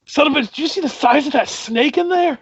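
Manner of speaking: high, startled guard behind a wall; muffled guard voice. Acted. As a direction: call it out like a shocked guard. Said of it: "a very muffled voice from outside being like".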